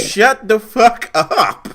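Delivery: high, loud, slow mock yell; drawn-out playful shout. Real, not acted. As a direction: stretch the yell out. Yelling at co-host.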